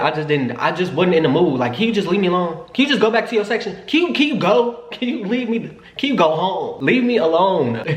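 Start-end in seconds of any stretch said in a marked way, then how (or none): 1.7-7.8 pleading whine. Retold or imitated. Begging him to leave.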